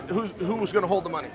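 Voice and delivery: usual speaking voice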